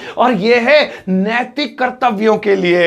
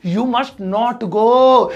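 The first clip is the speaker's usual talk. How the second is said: very slow, long GO; slow, emphatic dictation; drawn-out model sentence; 2 semitones higher, much slower than usual. Shown as a demonstration. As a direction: stretch each word out for the class. Teacher reads out English example answer.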